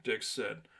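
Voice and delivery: plain reading voice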